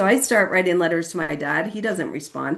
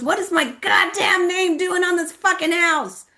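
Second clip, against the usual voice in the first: very high, incredulous shout; outraged yell; 14 semitones higher, 4 dB louder than usual. Retold or imitated. Friend sees his name on the charity house, outraged high yell. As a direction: imitate an exasperated friend yelling in protest.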